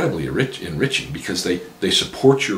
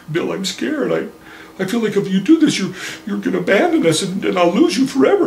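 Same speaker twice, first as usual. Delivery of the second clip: higher, pleading mother voice growing louder; fearful mother; 12 semitones higher, 6 dB louder than usual. Retold or imitated. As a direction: beg as a scared mother. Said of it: "pretends he's his mother, and his mother says".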